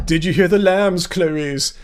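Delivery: a light Hannibal Lecter purr, lowering on the name; creepy Hopkins-style Lecter line, lightly done; mild Lecter impression; Anthony Hopkins accent. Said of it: "Did you like my Anthony Hopkins an-, accent?".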